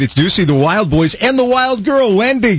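morning radio host patter. Pretend. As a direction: talk it like a morning show host. Radio host bit intro.

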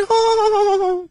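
shrill put-on giggle; high squeaky laugh.

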